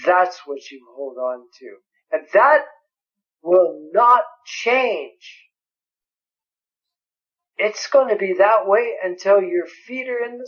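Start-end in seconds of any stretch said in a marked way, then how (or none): 2.1-5.4 very slow, weighty will not change; emphatic insistence. Acted. Preacher insists on permanence.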